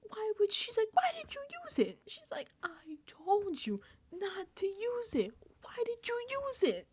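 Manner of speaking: high whispered mock scolding; a hint of furious Catherine, whispered; mock angry Catherine. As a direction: imitate her storming in, hushed and high. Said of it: "Catherine just storms in".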